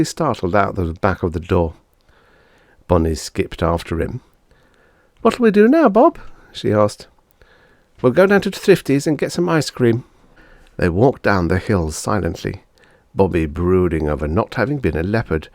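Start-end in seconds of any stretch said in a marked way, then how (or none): 5.2-10.0 put-on high, louder kids' voices; children deciding what next. Siblings, high voices.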